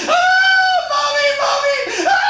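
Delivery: high shrieking cry for mommy; preacher acting a terrified child crying for its mother. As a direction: scream like a frightened toddler. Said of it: "a little child in its mother's arms".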